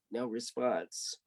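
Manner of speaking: dry deadpan refrain. A narrator's reading. Narrator's flat repeated refrain.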